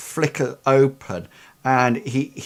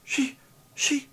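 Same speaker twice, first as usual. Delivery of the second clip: put-on high, anxious boy, faltering; fretful lad, pitched well up; nervous boy; 9 semitones higher than usual. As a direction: insist in a high, worried voice. Game master plays nervous boy.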